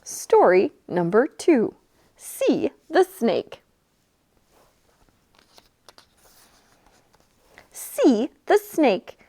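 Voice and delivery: slow, drawn-out picture-book reading; reading to children. A narrator's reading. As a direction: read each word slowly for little ones. Narration to children.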